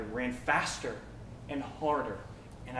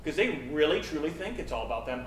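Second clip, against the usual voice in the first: ordinary delivery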